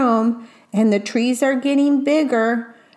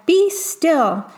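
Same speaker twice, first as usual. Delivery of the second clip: commanding; 6 semitones higher than usual. Acted. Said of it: "Till Max said".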